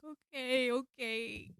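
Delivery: high, soft acted whimper; anxious compliance. Answering the helper in the retelling, anxious whimper. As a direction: agree weakly, still scared. Retold shaky obedience to helper.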